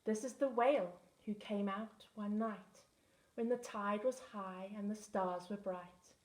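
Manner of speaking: usual speaking voice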